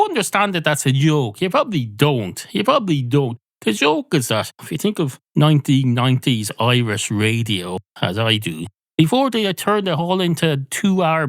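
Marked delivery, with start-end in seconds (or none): none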